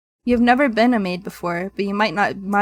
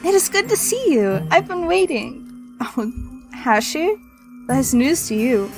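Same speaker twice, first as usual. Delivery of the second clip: put-on anime girl voice; 4 semitones higher than usual. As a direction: play a sweet anime girl. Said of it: "like an anime girl voice".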